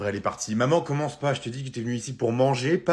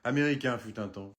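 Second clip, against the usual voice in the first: ordinary talk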